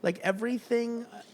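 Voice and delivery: hesitant LA voice, pitched well up; LA accent. Shown as a demonstration. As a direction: put on an unsure LA accent. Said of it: "when you speak in an L A accent".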